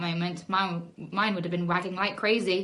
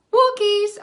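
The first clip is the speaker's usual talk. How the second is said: high, loud shout to the dog; 13 semitones higher, 11 dB louder than usual. Acted. Trevor shouting for his dog, loud. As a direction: yell the command across the woods. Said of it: "I shouted".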